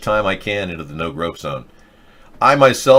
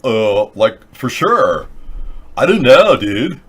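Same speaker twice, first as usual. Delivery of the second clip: a hint of a ditzy valley girl; light valley girl drawl; 2 semitones higher, 5 dB louder, slower than usual. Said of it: "talk like a valley girl".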